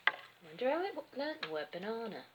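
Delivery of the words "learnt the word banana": plain reading voice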